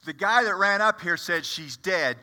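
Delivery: usual speaking voice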